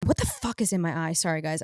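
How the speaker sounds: ordinary talk, nothing marked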